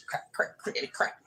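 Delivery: breathy crackling sound effect; whispered joint-cracking noises; whispered crackle. Pretend.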